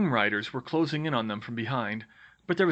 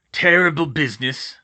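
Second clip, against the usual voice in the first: gruff, loud lament rather than a true mutter; big man's troubled muttering; muttered dismay; 3 semitones higher, 9 dB louder than usual. Acted. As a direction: grumble heavily, shaken by the news. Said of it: "he muttered".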